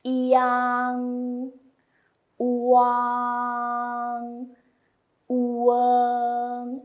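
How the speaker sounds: yang, wang, weng said loud and clear; teacher modelling sounds; Mandarin finals. Shown as a demonstration. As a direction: project each final. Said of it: "the fourth line is".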